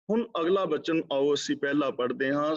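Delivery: usual speaking voice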